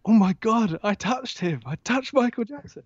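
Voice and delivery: high, breathless fan excitement; starstruck gushing. Retold or imitated. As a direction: gush like you can't believe it. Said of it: "I was like".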